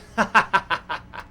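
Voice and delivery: loud short laugh; a hearty burst of laughter. Acted. Amused by Harry's act.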